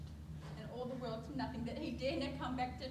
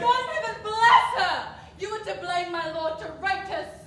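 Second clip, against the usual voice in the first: loud protest; 6 semitones higher, 17 dB louder than usual. Acted. Nurse defends Juliet.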